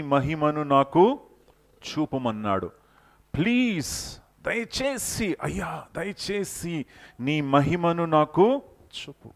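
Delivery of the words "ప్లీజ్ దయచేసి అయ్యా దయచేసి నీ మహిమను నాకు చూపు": high, slow, longing plea to God; Moses pleading. Acted. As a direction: beg for his glory like a yearning servant. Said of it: "ఎంతగా మోషే దేవుని కోసం ఆశపడ్డాడో".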